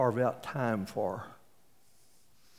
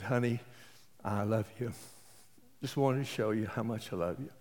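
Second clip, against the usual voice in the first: soft, low and loving; tender declaration; 3 semitones lower, 7 dB quieter than usual. Retold or imitated. Husband at the door with roses, softly loving. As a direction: hand her the roses and say it gently.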